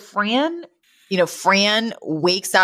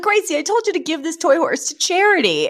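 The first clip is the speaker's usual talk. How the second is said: higher nasal Fran voice, scolding; Fran scolding Gracie; 10 semitones higher than usual. Retold or imitated. S0 imitating Fran scolding Gracie about the toy horse, high. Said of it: "she's like".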